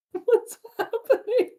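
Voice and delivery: very high squeal through laughter; helpless laughter. Real, not acted. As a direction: laugh so hard the question squeaks out. Still laughing in confusion.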